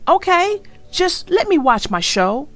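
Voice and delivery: anything, just let me watch; boy desperate to watch his show. Acted. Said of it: "Arthur was desperate".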